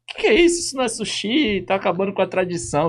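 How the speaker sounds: indignant Japanese guy. Retold or imitated. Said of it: "O cara".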